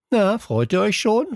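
high-pitched teasing question; grinning taunt. A narrator's reading. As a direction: ask it with a broad grin. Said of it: "fragte der Anführer mit einem breiten Grinsen".

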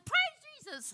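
very high-pitched squeal of praise; exuberant praise, imitated. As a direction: mimic yourself squealing in delighted worship. Re-enacting her praise, laughed at.

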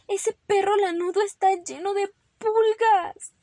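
high, loud cry of alarm; alarmed mother. Acted. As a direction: exclaim in horror at the pest. Mother alarmed about fleas.

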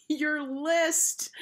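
high, laughing tease; cracked up laughing. A narrator's reading. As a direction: squeal it through mock laughter. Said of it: "she cracked up laughing".

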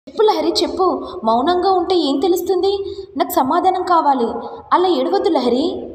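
insistent bullying demand; pressing demand. Acted. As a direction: badger her for an answer. Man demanding an answer.

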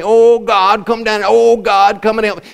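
loud, high imitation of desperate prayer; mock pleading. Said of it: "you act like you don't know where Christ is".

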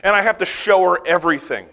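ordinary talk

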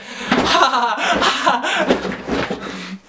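high laugh trailing off; helpless high laughter; long laugh. Real, not acted. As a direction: burst out in a drawn-out laugh. Chair spun round, laughing.

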